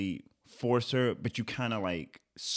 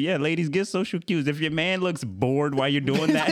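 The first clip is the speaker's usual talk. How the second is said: ordinary talk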